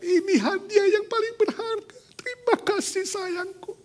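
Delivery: high, bright-pitched gratitude; overjoyed wife. Acted. Wife thanking her husband for the best gift, high and joyful. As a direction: gush with joy.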